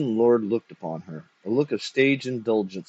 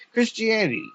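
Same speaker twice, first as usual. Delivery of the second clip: very high, incredulous snap; high-pitched indignant outburst; snapped; 12 semitones higher than usual. A narrator's reading. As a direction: squawk it back in disbelief. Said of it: "snapped the jaded near corpse".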